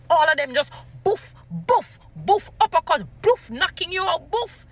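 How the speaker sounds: high, loud "boof" punch noises; punch sound effects. Retold or imitated. Commentator acting out knockout punchlines, punchy mock impacts. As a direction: throw each boof like a landed punch. Imitating punches landing on an uppercut.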